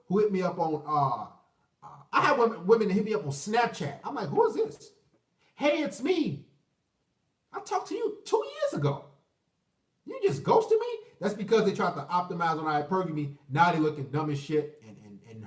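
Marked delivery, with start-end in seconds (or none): 4.3-4.9 high-pitched puzzled question; mock baffled reply. Man getting a Snapchat message from a woman, mock baffled. 5.6-10.9 high-pitched mock exchange, incredulous by the end; imitated returning ex. Woman back after two years of silence, mock incredulous.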